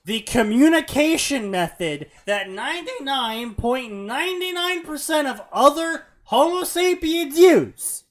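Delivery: loud, hammering emphasis; exasperated rant. Real, not acted. Ranting at the story's character.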